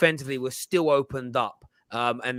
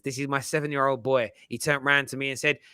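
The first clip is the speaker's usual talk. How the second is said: ordinary talk, nothing marked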